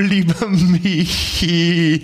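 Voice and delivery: sanft und beruhigend, gedehnt; soothing. Acted. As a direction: wie man ein Kind tröstet. Soothes the ranting friend. (beruhigende Stimme)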